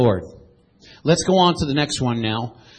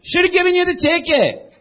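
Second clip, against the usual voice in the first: mock exasperation; 12 semitones higher, 4 dB louder than usual. Preacher quips about the officer.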